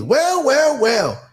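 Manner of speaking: high mock preacher's well, well, well; funny little voice. Imitating the pimp preacher's greeting, a high funny voice. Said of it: "he had this little funny voice".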